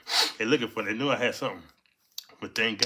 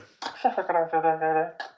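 loud laugh; 7 semitones higher, 5 dB louder than usual. Real, not acted. Laughing at hiding scene.

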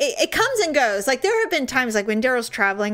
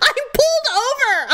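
high, emphatic protest; exasperated yelling back; 14 semitones higher, 4 dB louder than usual. Retold or imitated. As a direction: imitate a harried driver. Driver yells back at her.

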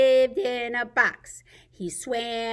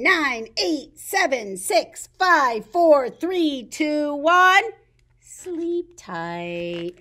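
ordinary talk, nothing marked

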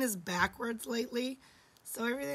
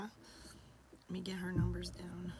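whispered through a lost voice; breathy whisper, voice gone; 2 semitones lower, 4 dB quieter than usual. Real, not acted. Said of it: "I've lost my voice".

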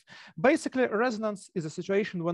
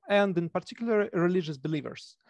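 ordinary talk, nothing marked

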